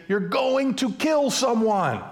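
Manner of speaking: high, drawn-out alarmed call; high, slow warning yell. Retold or imitated. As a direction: shout the warning across the road. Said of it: "And they say".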